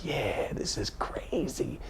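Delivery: slow unvoiced whisper; whispered aside. Son's private thought while playing catch, slow whisper. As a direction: breathe it like a secret thought.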